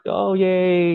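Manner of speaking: put-on boy jumping around after his fall, high and gleeful; happy kid. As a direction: squeal it like a kid bouncing back. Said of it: "And he'll be like".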